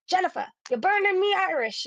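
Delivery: playful Irish grandma telling her off; mock mad Irish grandma. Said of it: "when she was mad, she would say".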